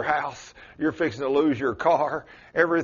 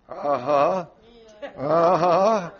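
usual speaking voice

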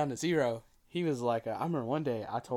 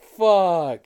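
loud, startled curse; sudden loud swear on noticing the shirt; shocked curse; 7 semitones higher, 15 dB louder than usual. Retold or imitated. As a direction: blurt it out in panic. Realizing he's wearing the wrong shirt.

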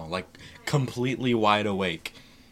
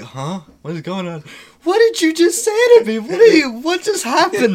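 high, loud, laughing confusion; baffled shout; 11 dB louder than usual. Retold or imitated. Just woke up to girlfriend's news, high laughing bafflement. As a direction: yelp it out, totally lost. Waking up baffled, quoting self.

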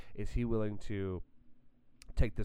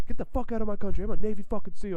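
put-on higher, louder drunk bully yell; mock angry shout; 11 semitones higher, 14 dB louder, faster than usual. Drunk man on the bus yelling at the speaker, loud mock shout. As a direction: bellow it like the raging guy on the bus. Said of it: "he said".

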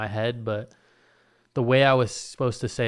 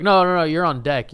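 raised, higher host cutting in; urgent correction; 5 semitones higher, 8 dB louder than usual. Retold or imitated. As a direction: cut them off loudly as the host. Host stopping him getting up.

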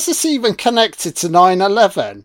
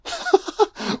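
a sudden high giggle, caught off guard; high burst of laughter; 6 dB quieter than usual. Real, not acted. Laughing at the film's cheap set, a high burst of laughter. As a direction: let a high laugh escape. Laughs at cheesy film scene.

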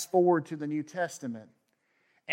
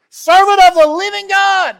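anguished cry; 16 dB louder than usual. Acted. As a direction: cry his name out in anguish across the den. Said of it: "he cried out in anguish to Daniel".

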